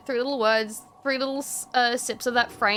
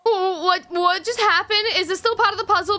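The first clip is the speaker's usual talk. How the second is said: shocked; 9 semitones higher, 5 dB louder than usual. Real, not acted. Shocked at sudden killing.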